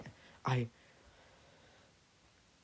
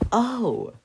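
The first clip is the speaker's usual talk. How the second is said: a high, loud burst of discovery; sudden realization; 12 semitones higher, 8 dB louder than usual. Real, not acted. Remembering the word, a loud surprised cry. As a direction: blurt it out as it clicks.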